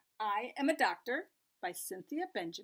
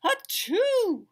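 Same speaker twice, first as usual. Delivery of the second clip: fake sneeze; 12 semitones higher, 12 dB louder than usual. Reading sneeze in picture book.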